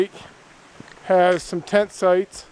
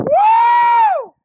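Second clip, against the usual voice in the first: joyful whoop; 7 dB louder than usual. Real, not acted. Joyful whoop after a swim.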